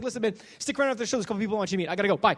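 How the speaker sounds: fast, rushed words; hurried goodbye. Retold or imitated. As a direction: rattle it off on the way out. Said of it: "He's like".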